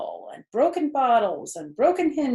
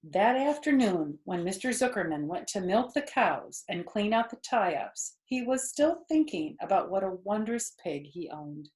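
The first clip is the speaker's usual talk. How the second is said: ordinary delivery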